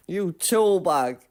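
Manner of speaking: self-scolding. Real, not acted. Gamer calling himself a tool bag, slow and loud. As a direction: drag out the insult, half laughing at yourself. Self-insult after mistake.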